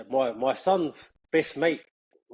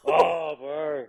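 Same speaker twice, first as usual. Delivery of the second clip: ordinary delivery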